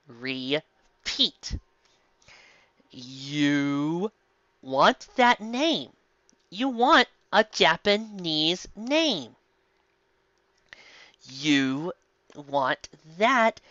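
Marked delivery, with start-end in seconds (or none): 2.9-13.6 slow mocking.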